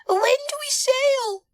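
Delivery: very high, eager little character; squeaky-high excited voice. A narrator's reading. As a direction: ask it bursting with excitement. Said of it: "asked Bonnie excitedly".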